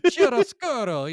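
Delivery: loud, high, hammed-up Italian accent; bad Italian accent. Shown as a demonstration. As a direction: belt out "chiaroscuro" like a cartoon Italian. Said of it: "the shitty Italian accent".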